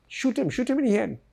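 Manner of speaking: lightly voiced attacker, pitched high; mock the gunman's urgent order, high; imitating attacker. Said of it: "somebody say".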